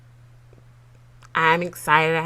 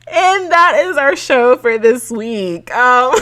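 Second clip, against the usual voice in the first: excited; 7 semitones higher, 11 dB louder, slower than usual. Real, not acted. Laughing wrap-up.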